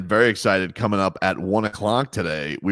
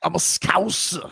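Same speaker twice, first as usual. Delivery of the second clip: higher, slow put-on Scouse accent; 7 semitones higher, 4 dB quieter than usual. Host imitating the fighter's Liverpool Scouse accent, higher and slow. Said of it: "what does he say".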